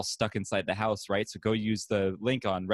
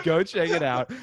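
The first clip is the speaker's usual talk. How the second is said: laughing; 6 semitones higher, 5 dB louder than usual. Acted. Repeat of promo line.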